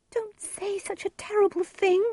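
very high, distressed protest; crying out. A narrator's reading. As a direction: protest in a high, shocked girl's voice. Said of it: "Juliet cried".

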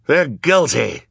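high, loud verdict; villain's cry. A narrator's reading. Villainous man declaring the verdict early, high loud cry. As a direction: cry out the accusation. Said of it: "cried the man with the beard".

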